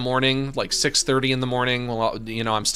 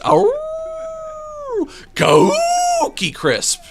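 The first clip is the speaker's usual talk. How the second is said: long, high, loud howl; howl demo; 7 dB louder, much slower than usual. Shown as a demonstration. Showing what a real dog howl sounds like, slow high howl. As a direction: stretch it out slowly into a proper wolf howl. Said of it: "A howl is".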